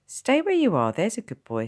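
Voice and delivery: high, loud sing-song coaxing. Acted. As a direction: coax him like a small child. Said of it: "sing-songed the fair-haired nurse".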